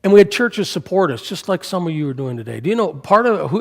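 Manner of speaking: ordinary talk, nothing marked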